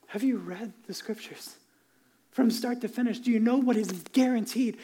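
ordinary talk

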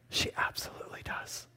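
whispered, tender answer; hushed Jesus reply. Retold or imitated. Jesus tenderly whispering to the sinful woman. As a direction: breathe it softly as Jesus.

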